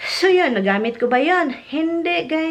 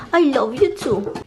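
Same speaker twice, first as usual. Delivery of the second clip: normal talking voice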